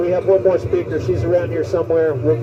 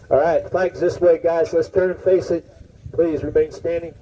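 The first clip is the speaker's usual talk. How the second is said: ordinary delivery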